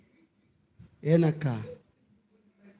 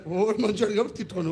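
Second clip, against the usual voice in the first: normal talking voice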